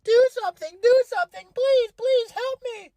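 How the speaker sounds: hammed-up, very high squealed pleading; imitating a girlfriend bawling her eyes out. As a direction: wail and beg in a squeaky mock cry. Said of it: "starts bawling her eyes out".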